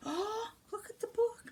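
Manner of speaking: high, bright gasp over the book; gasping oh, pitched high for a little one; delighted gasp. Real, not acted. Showing book to small child.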